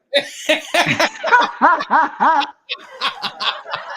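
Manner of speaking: high laugh. Real, not acted. Laughing at toilet accusation.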